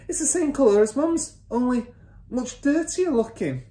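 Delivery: put-on high-pitched boy's observation; son's voice. A narrator's reading. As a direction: pipe up as the son. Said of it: "the son said".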